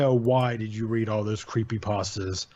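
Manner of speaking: plain reading voice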